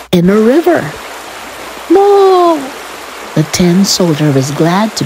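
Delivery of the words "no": high, drawn-out no; high-pitched acted alarm; cry of dismay. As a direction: wail it as the boat plunges. Soldier's boat lands in river.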